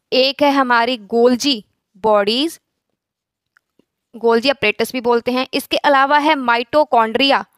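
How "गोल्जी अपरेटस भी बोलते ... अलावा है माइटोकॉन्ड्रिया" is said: ordinary talk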